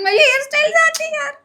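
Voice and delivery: high pitched whining protest; squeaky protest over her hair; high whine. Real, not acted. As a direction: whine about the ruined hairstyle. Blindfold ruining her hairstyle.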